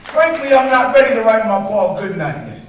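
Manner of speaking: rhythmic spoken word performance, building and falling; spoken word poem. Acted. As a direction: perform it like a slam poem on an open mic stage.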